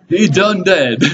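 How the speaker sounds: high, laughing country-style quip. Real, not acted. Preacher joking about the dead enemy in country talk, laughing. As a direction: toss out the joke through laughter.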